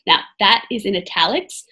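ordinary delivery

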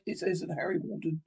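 ordinary talk, nothing marked

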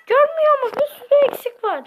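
high, loud put-on voice; squeaky character voice. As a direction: play a shrill cartoon kid. Same character scolding.

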